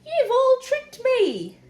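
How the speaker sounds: high, loud, drawn-out wail; howling. A narrator's reading. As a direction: howl the accusation slowly. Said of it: "he howled".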